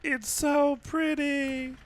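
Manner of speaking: very high, slow, drawn out; high squeaky voice. Pretend. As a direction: stretch it out in a squeaky voice. Echoing "so beautiful" mockingly.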